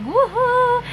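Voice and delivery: high, whooping imitation of the drunk friend; drunk cheer. The drunk friend cheering in the car, high tipsy whoop. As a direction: mimic her tipsy party whoop. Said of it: "she was like".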